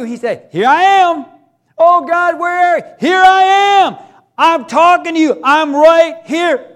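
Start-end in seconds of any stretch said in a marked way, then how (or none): 0.5-1.3 loud, higher, insistent reply; God answering loudly. Acted. God calling back to the crying believer, loud and insistent. 1.8-2.8 louder still, shouted plea; shouting to God. Retold or imitated. Believer in battle shouting out to God, louder and desperate. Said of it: "when you're crying". 3.0-6.7 loud, higher, ending slow and emphatic; God shouting back. Acted. God shouting back to the believer who can't hear, slowing at the end.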